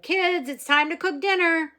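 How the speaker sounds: put-on father hollers to children upstairs; hollering. A narrator's reading. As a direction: call the kids down for dinner at full volume.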